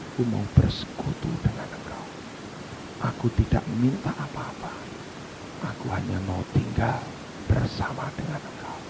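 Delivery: hushed, low, airy near-whisper; low and soft, drawing close; tender plea. Acted. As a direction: plead softly and intimately. Voices tender plea of the Spirit.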